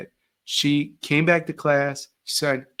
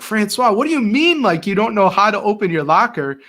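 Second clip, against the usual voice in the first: exasperated outburst, much higher and louder; teacher losing patience with a student over his locker, frustrated; mad and frustrated; 6 semitones higher, 5 dB louder than usual. Retold or imitated. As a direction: scold the kid in frustrated disbelief. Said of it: "I would get so mad and frustrated".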